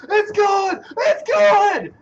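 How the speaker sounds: high, loud "It's good!" cheer; excited shout. Retold or imitated. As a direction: yell it like the kick is in. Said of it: "watch me, shout".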